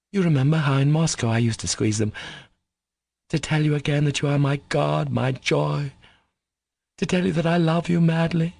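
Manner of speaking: rising, fervent; Katya's declaration. Acted. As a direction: build to love you madly.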